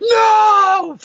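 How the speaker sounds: high, loud anguished no; anguished cry. Acted. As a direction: scream it over a fallen friend.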